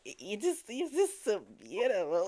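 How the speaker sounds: high, gushing mock swoon, breaking into laughter; laughing pretend lovesick gushing; mock adoration. Jokey mock adoration of friend.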